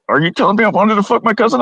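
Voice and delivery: high, disbelieving put-on voice; mock horror, pitch up; horrified question. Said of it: "she goes".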